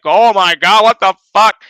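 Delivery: loud, panicky mock shriek; freaking out like a girl. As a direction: squeal it like a scared kid. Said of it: "freaking out like I'm a ten-year-old girl".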